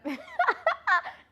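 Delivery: loud squealing laugh; high burst of laughter. Real, not acted. Laughing at own question.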